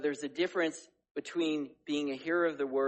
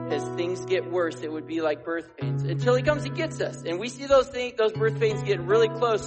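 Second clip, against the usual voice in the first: raised, urgent preaching voice; preaching at full force; 4 semitones higher, 4 dB louder than usual. Acted. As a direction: build up to a strong sermon pitch.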